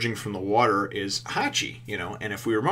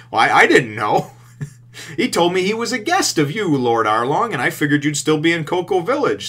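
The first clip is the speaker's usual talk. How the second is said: a hint of a higher, louder bumbling voice; flustered excuse; 7 semitones higher, 7 dB louder than usual. Hachi excuses himself to Arlong, lightly voiced bumbling. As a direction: stammer out a sheepish excuse.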